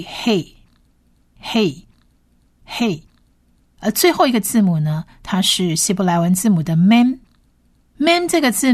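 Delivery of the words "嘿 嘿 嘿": "嘿" said slowly, softer each time; syllable demo. Shown as a demonstration. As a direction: sound out "呵A嘿" slowly. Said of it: "所以是呵A嘿". (慢速拼读嘿)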